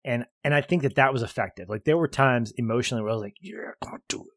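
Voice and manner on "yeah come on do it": retold hushed cheering-on; whispered urging. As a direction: whisper it like secretly rooting for them. Retold emotional urging in theater.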